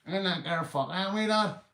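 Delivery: high helium-like Belfast voice; squeaky Belfast accent. Acted. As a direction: mimic a Belfast lad on helium. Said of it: "It's like I sniffed helium".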